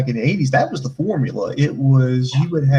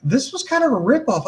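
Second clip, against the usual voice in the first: high, mock indignant; sarcastic complaint; 10 semitones higher than usual. Host voicing his reaction, high indignant. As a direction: whine it's a ripoff. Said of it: "And I'm like".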